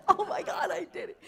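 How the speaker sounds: high triumphant cry broken by laughter; laughing exclaim. Retold or imitated. Retelling waking up to the solved equation, laughing high exclaim. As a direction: laugh through the memory of the win.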